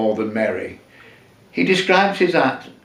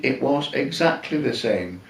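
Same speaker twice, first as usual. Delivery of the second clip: ordinary delivery, nothing marked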